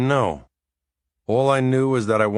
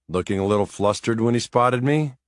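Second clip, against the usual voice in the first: plain reading voice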